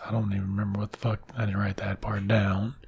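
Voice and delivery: quick whispered aside; hushed aside. Real, not acted. Storyteller admitting he forgot, whispered quickly. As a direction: drop to a whisper, fast. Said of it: "aside to audience".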